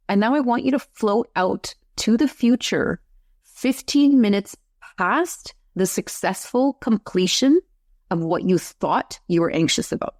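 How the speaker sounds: slow, drawn-out guiding voice; guided hypnosis voice. Acted. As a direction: lead them gently into trance. Guides client through timeline.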